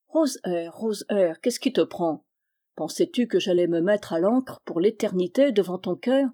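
usual speaking voice

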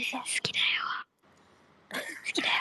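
soft unvoiced loving whisper; ASMR whisper. Streamer whispering love to the teacher, ASMR whisper. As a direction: whisper the confession close. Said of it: "エーエスエムアール化してる？".

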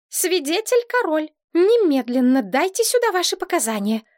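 put-on high, imperious child giving orders; commanding. A narrator's reading. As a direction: order the witness to speak, pitch up. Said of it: "скомандовала Элька". (командным тоном)

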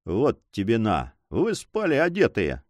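ordinary delivery

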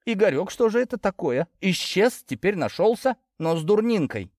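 a mother fretting over her son; hint of an anxious mom; mother's voice. A narrator's reading.

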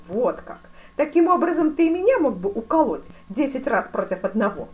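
loud, high imperious grand duke; великий князь, напористый. A narrator's reading. (громко и напористо)

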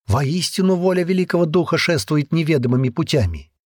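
high, thrilled exclamations; excited crowd. Acted. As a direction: burst out in amazed joy. Villagers' excited clamor.

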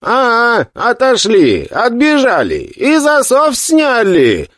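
high, drawn-out cheerful yell; excited shouting. Acted. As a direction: call out high and slow in glee. Defenders shout in relief. (радостный крик)

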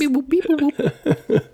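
high beeping robot noises; robot beeps. Pretend. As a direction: beep it out like a toy robot. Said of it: "I'm robot".

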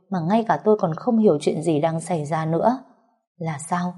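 usual speaking voice

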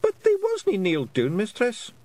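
high, lilting Scots wee-man voice; a tiny Scots chief, earnestly explaining; Feegle voice. Acted. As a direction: Rob Anybody explaining to Tiffany, high Scots voice. Rob excuses the theft.

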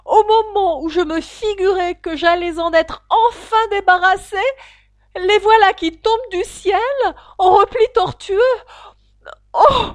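high, loud, rising to a cry; pigeon crying out. Acted. Pigeon shrieking at the serpent it sees, building to a cry. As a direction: screech it in outrage. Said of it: "en élevant sa voix jusqu'à crier".

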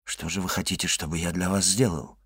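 soft, low, gentle question; hushed, conciliatory tone; meek. A narrator's reading. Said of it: "кротко сказал Башан".

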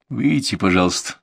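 usual speaking voice

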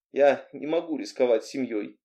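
ordinary delivery

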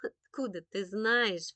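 slow, scoffing question; dismissive big-sister snort; snorting scepticism. A narrator's reading. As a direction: scoff at a little brother, drawn out. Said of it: "фыркнула Виктоша".

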